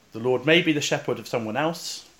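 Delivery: ordinary delivery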